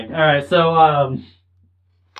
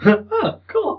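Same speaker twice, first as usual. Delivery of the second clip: laughing squeak. Real, not acted.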